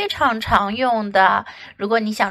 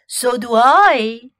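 slow, louder honking goose; 5 dB louder than usual. Acted. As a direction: drawl it like a big goose. Goose speaks slowly.

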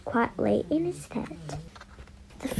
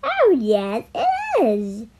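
pantomime ugly sister. A narrator's reading. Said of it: "ugly sisters called out".

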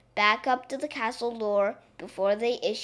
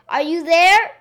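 loud, high storybook call; raised voice, pitched up and carrying; 7 semitones higher, 12 dB louder than usual. A narrator's reading. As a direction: call out to someone hiding. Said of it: "called out the little princess".

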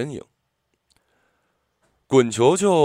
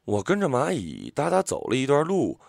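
ordinary delivery, nothing marked

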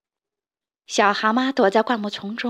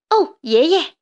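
higher and louder joyful cry; excited cry; 6 semitones higher, 5 dB louder than usual. Acted. Little toad runs out to grandpa, high excited cry. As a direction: call out to grandpa in wonder. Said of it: "他叫道".

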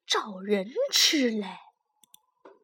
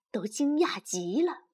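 ordinary delivery, nothing marked

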